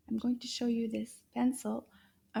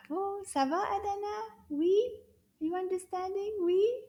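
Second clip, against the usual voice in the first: high cheerful praise; 9 semitones higher than usual. Real, not acted. Cheering the pupil.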